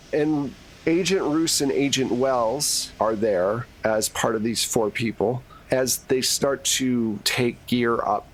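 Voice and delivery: ordinary delivery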